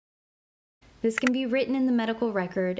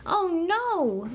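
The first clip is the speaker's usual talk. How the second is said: surprised oh no; 9 semitones higher than usual. Acted. Story narrator reacts to fall.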